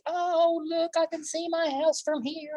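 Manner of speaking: high, delighted voice of a mouse floating up; thrilled flying. Acted. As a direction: squeal with joy high above the town. Nibs flying on umbrella.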